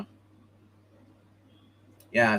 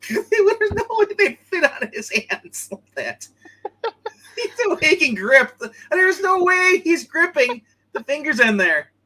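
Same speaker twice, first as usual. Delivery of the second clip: high laughing disbelief; 7 dB louder, slower than usual. Real, not acted. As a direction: talk through real laughter. Laughing at the comic panel.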